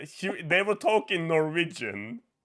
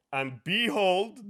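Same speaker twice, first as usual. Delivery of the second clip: usual speaking voice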